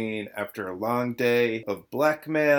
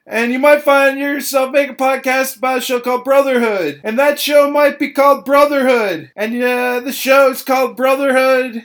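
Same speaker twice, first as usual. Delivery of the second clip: much higher and louder, a frantic rant; loud song parody; 9 semitones higher, 11 dB louder than usual. Real, not acted. Host rants about his own podcast, loud and high.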